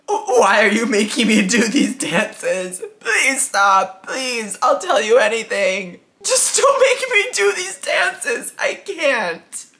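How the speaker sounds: high, loud wailing plea; hammed-up sobbing, pleading desperately for it to stop; tearful begging. Shot girl begs to stop the dances, wailing in tears.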